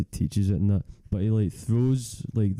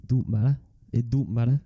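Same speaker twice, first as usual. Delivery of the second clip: imitating Jordan Henderson; 3 semitones higher than usual. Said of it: "What was it Jordan Henderson kept saying".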